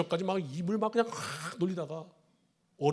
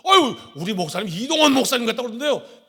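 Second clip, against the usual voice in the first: excited flattery; 7 semitones higher, 9 dB louder than usual. Retold or imitated. Deacon gushing flattery.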